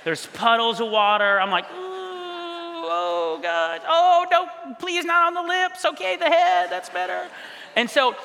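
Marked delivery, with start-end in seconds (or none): 1.7-7.3 high-pitched nervous whimpering, acted out; anxious squirming. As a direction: squirm through it in a high, worried voice. Anxious germaphobe watching relatives kiss baby.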